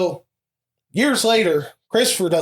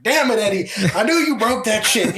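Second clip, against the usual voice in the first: raised, mildly exasperated mom voice; a hint of a mother catching her son out; mom scolding; 6 semitones higher than usual. Mom scolding her son over the broken cabinet. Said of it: "And she was like".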